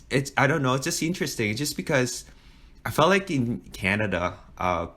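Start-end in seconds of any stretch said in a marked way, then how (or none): none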